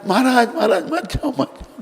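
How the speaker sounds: high-pitched wail of suffering souls; crying out, pleading. Acted. Suffering souls begging King Janak not to go, crying out. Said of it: "वो चिल्लाए".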